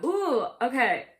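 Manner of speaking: normal talking voice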